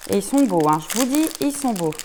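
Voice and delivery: loud, delighted gushing over the beads; thrilled exclamation, loud enough to apologise for; excited gush. Real, not acted. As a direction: burst out with real delight. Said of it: "Désolée pour vos oreilles".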